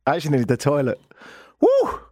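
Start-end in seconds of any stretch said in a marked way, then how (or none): 1.6-2.0 very high, loud woo; whoop. Real, not acted. Host whoops after scaring himself, very high and loud. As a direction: let out a shrill whoop of relief.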